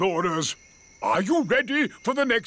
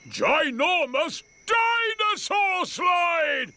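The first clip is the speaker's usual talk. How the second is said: excited; 8 semitones higher, slower than usual. Real, not acted. Grampy shows off dinosaur slide.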